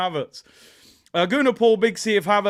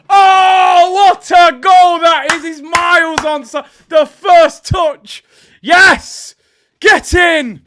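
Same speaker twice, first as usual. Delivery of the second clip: high, loud, drawn-out amazement; exhilarated shouting; 9 semitones higher, 13 dB louder, much slower than usual. Real, not acted. Streamer seeing the goal is onside, exhilarated shout. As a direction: roar in awe at a brilliant goal.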